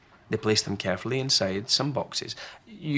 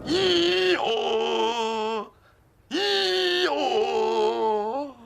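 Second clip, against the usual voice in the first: loud high hee-haw; high drawn-out donkey call; donkey bray; 14 semitones higher, 4 dB louder than usual. Acted. Donkey brays.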